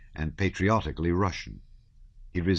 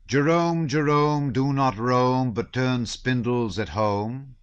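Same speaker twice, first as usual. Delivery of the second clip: higher, slow teasing proverb; a sly joker quoting a proverb at dinner, higher and slow; 7 semitones higher, 5 dB louder, slower than usual. A narrator's reading. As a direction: tease them with the rhyme, smiling. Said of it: "puckering his brows and smiling".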